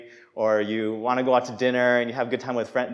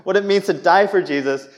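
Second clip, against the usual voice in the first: loud, high defensive excuse; a touch of defensive piety; brushing it off; 6 semitones higher, 6 dB louder than usual. Retold or imitated. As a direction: brush the question off with a pious line. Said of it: "tried to brush it off".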